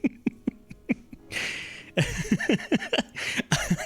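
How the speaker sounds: breathless high-pitched wheeze of laughter; high wheezing laugh. Real, not acted. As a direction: dissolve into a silent squeaky laugh. Cracking up at own bit.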